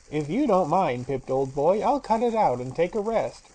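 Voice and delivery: loud, lazy machine voice; brash and careless; brazen phonograph. Acted. Phonograph telling the magician it quits, loud and brash. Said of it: "in a brazen, scratchy voice".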